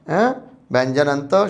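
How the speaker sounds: ordinary delivery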